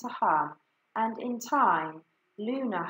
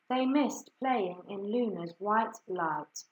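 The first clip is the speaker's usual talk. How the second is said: usual speaking voice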